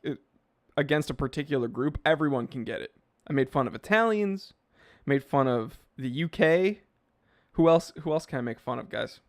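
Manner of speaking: ordinary delivery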